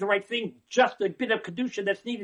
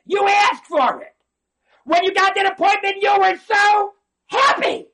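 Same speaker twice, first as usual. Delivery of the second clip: loud scolding; 11 semitones higher, 8 dB louder than usual. Real, not acted. Scolding the complaining patient.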